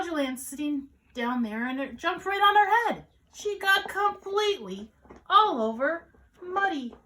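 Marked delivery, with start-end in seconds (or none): 1.7-6.9 high, loud, animated narration; excited storytime climax; exaggerated storytelling. A narrator's reading. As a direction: play up the mess for little listeners. Comic climax for young listeners.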